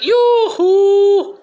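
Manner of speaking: high, loud yoo-hoo holler; whoop. Acted. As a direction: holler it out like a cheer. Cheerful whoop.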